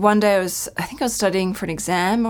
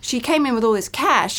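higher, chirpy imitation of a bank teller; 9 semitones higher than usual. Teller telling her father about the cash, higher-pitched imitation.

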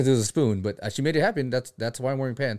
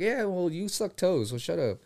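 retold mock comeback; 6 semitones higher, 3 dB quieter, slower than usual. Acts out his comeback to critics.

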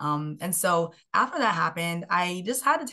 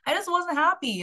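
ordinary talk, nothing marked

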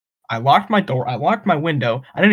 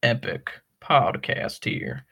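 mock Southern; 3 semitones lower than usual. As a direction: play a courtly Southerner, unhurried and low. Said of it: "is a southern accent".

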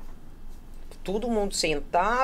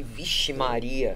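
awed exclamation; 6 semitones lower, much slower than usual. Retold or imitated. Shivers watching the ritual.

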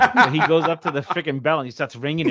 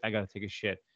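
low, soft deadpan dog voice; lightly voiced dog, dropped low and quiet; dog's deadpan demand; 4 semitones lower, 11 dB quieter, faster than usual. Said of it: "He's like".

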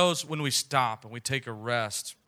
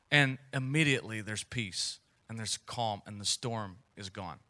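ordinary talk

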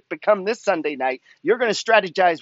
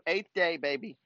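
ordinary delivery

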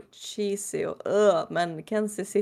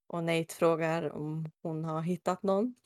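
usual speaking voice

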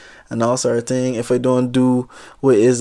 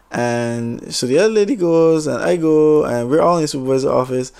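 normal talking voice

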